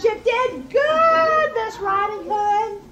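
high shout with a long drawn-out middle; jubilant cry. A narrator's reading. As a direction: cheer the rescuer at full voice. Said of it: "stood and cried".

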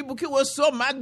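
ordinary delivery

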